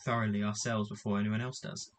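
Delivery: ordinary delivery, nothing marked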